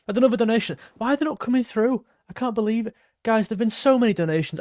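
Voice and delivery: high-pitched, overwhelmed disbelief; real happy disbelief. Real, not acted. Streamer amazed at so many donations, happy disbelief. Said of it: "unbelievably happy".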